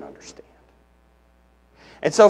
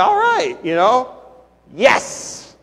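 mock cheering; 6 semitones higher, slower than usual. Imitating worshippers' casual joy, light cheer. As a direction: cheer it like a happy crowd. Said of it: "It means something like".

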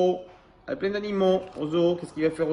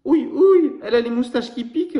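much higher and louder whiny complaint; grumbling; 10 semitones higher, 8 dB louder than usual. Acted. Baby koala grumbles at the sea lion's kiss, in a high whiny voice. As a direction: whine about the prickly whiskers. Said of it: "ronchonne bébé koala".

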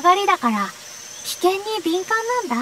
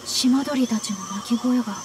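low, hushed, much softer warning; relaying the birds' warning; 10 semitones lower, 11 dB quieter than usual. Acted. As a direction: voice the birds' omen in a low murmur. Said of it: "鳥の言葉、わかるの？". (鳥の言葉を低く静かに伝える)

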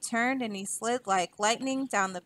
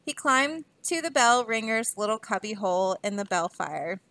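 plain reading voice

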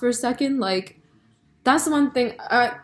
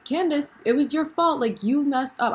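higher-pitched scolding, mildly exasperated; retold viewer's blame, pitched up; mock mad; 6 semitones higher than usual. Viewer blaming Candace for her own death, mildly mad. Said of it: "I was so mad at Candace".

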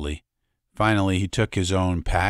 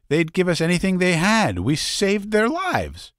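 high-pitched, raised over engine noise; smug young woman; 12 semitones higher, 3 dB louder than usual. Acted. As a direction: boast about their power. Sylvia smug over the racket.

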